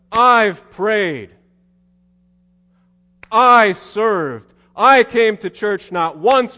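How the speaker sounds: very slow, loud, high and bitter; elder son ranting at his father, slow and loud; furious rant. Acted. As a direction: hammer each claim like a wronged servant. Elder brother ranting like a servant.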